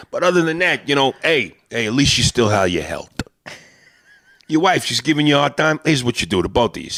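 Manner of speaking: put-on chatty Italian-American wiseguy accent; Italian-American street talk, "health" as "helt"; Italian-American guy. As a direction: swap advice like old-school Italian-American pals. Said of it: "They don't pronounce the H".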